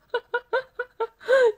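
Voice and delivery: high, loud burst of laughter. Real, not acted. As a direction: crack up out loud. Reacting to a viewer's comment.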